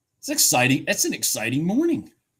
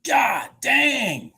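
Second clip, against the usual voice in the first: excited exclamation; 8 semitones higher, 4 dB louder, much slower than usual. Real, not acted. Trader reacting to a big move.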